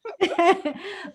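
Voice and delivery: high amused laugh. Real, not acted. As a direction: giggle at being teased. Laughs at the teasing.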